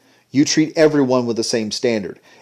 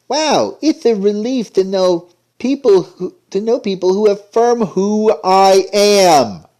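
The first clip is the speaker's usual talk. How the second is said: much higher, slow, gushing mock voice; mocking relieved kid; 8 semitones higher, slower than usual. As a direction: overplay the relief. Mocking cartoon dialogue.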